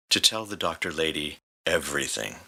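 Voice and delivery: plain reading voice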